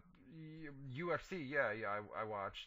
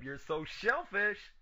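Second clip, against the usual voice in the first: slow, loud, higher punchline; punchline delivery; 7 semitones higher, 6 dB louder, much slower than usual. Acted. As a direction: drag out the pun for effect. Punchline of a pun.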